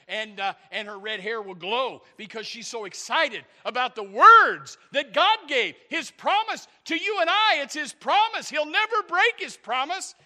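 building to high, shouted proclamation; impassioned pulpit shout; full-force preaching. Real, not acted.

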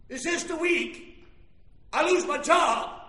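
slow, emphatic question. Acted. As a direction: stretch out the question with force. Same worry repeated louder.

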